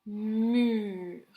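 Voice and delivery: "mur" stretched sound by sound; lengthened "mmuuurr"; sounding out the word. Shown as a demonstration. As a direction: draw out "mur" slowly so every sound is heard. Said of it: "en écoutant bien les sons".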